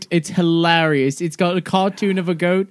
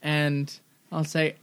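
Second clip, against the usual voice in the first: ordinary delivery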